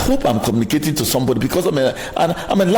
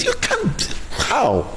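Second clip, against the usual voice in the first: voice breaking; 11 semitones higher, 3 dB quieter, much slower than usual. Acted. Acted wife breaking down.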